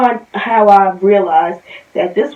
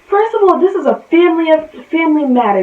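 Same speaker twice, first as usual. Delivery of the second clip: angry; 7 semitones higher, 4 dB louder, faster than usual. Real, not acted. Angry rant at wife.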